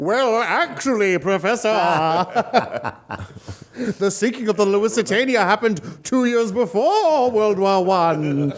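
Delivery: put-on know-it-all student voice; obnoxious high schooler. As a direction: correct the teacher in a silly pedantic voice. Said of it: "high school voice too".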